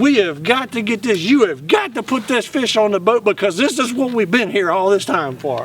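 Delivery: high, emphatic mock yell; exasperated insistence, pitched high; retold yelling. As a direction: hammer out the order, worked up. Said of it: "Jeff's yelling at me".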